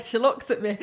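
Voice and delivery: ordinary talk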